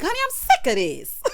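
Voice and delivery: high exasperated laugh through the words. Real, not acted. Laughing at the cast while recapping. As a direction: laugh it off in disbelief.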